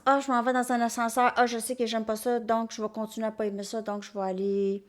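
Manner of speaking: rapid run-on inner monologue; anticipating dread. Acted. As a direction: rattle off the self-talk of someone bracing for the lift. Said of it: "on se prépare d'avance".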